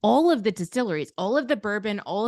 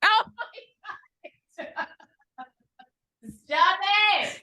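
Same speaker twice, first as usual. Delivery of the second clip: shocked laugh, much higher; laughing disbelief; 12 semitones higher, much slower than usual. Real, not acted. Friend hearing about the harem offer, laughing in shock. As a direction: squeal it in disbelief.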